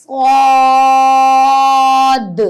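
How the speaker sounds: صاد loud, long and thick; heavy saad. Shown as a demonstration. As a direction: stretch صاد heavy and full. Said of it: "تو ہم پھر سے پڑھتے ہیں".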